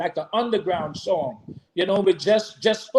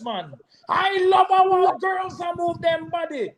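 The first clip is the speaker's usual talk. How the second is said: shouted toast; 7 semitones higher than usual. Acted. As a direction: hype the crowd at full voice. Performing the song's toast.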